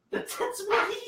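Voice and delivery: very high, slow squeaky question; mocking echo. Co-host echoes the silly phrase, high mocking voice.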